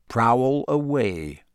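slow, menacing and unhurried; full of menace. A narrator's reading. Moody to Snape on the stairs, slow menace. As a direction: stretch each word out, letting the threat sit in them. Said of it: "his voice was full of menace".

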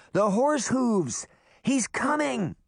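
high, urgent cry; alarmed warning. A narrator's reading. Daphne warning the others of the horseman, high alarmed cry. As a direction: raise the alarm with pitch way up.

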